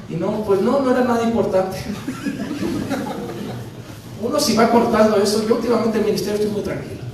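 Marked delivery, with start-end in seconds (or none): 1.8-4.2 soft airy laugh through the nose; breathy laugh. Real, not acted. Pastor laughing at his own story, soft and airy. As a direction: let out a quick breathy laugh.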